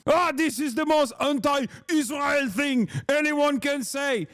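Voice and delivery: high, hammed-up horrified wail; mock outraged Zionist. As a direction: overplay scandalised fury with an accent. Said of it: "getting mad at Miss Rachel".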